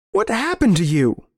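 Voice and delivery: high, distraught question; appalled plea. Acted. Narrator appalled at his old friend's change, anguished question. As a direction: demand it in anguish, pitch up high.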